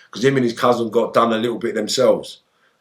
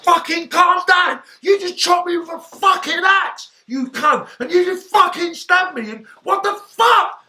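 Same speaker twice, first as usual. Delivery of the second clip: furious shout; slower than usual. Retold or imitated. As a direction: scream it at the men trying to calm him. Retold furious shouting at attackers.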